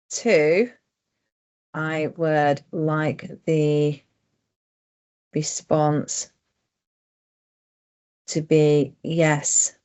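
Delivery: very slow, word by word, long gaps between; slow dictation. Shown as a demonstration. Presenter dictating a survey change to the computer, slow and deliberate. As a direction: spell it out slowly so the dictation catches every word. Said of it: "So I'm going to just dictate".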